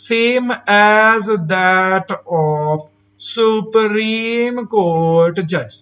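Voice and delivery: very slow, stretched word by word; slow, measured dictation; drawn-out key phrase. Real, not acted. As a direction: draw every word right out for the class. Teacher spells out key phrase slowly.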